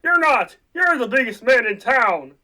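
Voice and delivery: hot-tempered outburst of loyalty; heated defense. Acted. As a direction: stand up for your father. George defends his father.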